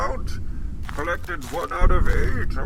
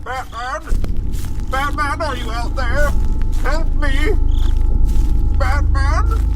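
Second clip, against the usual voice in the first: calling out; 4 semitones higher, 5 dB louder than usual. Acted. Calling out for Batman.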